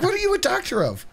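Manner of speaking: very high, quick retold question; mock incredulity. Host retelling friend challenging the doctor claim, mock incredulous. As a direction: imitate a friend's squeaky disbelief.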